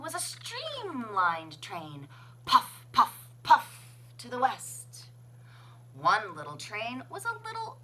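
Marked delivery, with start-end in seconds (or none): none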